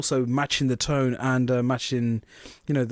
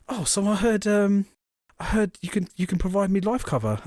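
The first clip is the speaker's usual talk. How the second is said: higher-pitched, hammy advert customer; bad advert acting; 6 semitones higher than usual. Middle-aged man phones about life cover, badly acted advert voice. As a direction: imitate a wooden caller in a cheap advert. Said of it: "the acting is so bad in these adverts".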